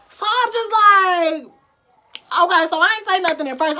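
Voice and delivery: a mild shocked squeal; worked-up. Real, not acted. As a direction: wind up the outrage. Hearing about her ex.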